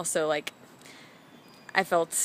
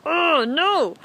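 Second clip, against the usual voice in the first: high, louder acted refusal; mock refusal; 8 semitones higher, 5 dB louder than usual. Storyteller refusing the voice in her head, high mock protest. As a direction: push back in mock protest, pitched high.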